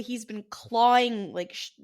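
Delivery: usual speaking voice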